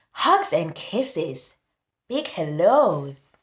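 put-on sing-song read-aloud for kids; storybook reading. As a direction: read it like a bedtime rhyme. Reading picture book aloud.

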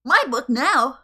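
mock villain.

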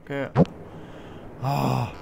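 ordinary talk, nothing marked

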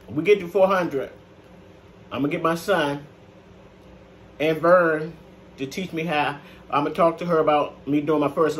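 chewing between words; talking while eating. Real, not acted. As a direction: mouth full of noodles. Seen 3: Forkful of noodles at her mouth throughout; she bites near the end.

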